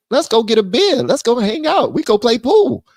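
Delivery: mock-friendly pal inviting you out; easygoing buddy. Conservatives voiced as friendly buddies.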